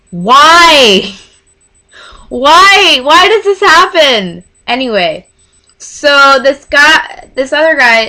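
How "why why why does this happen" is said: loud, high wail of frustration; louder, raised, pleading with the universe; exasperated outcry. Real, not acted. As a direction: cry out in comic despair. Exasperated outcry at men flirting with her.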